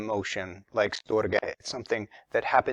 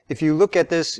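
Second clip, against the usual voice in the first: ordinary talk, nothing marked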